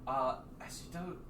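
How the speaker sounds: ordinary talk, nothing marked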